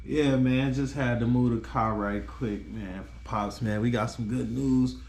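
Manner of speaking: usual speaking voice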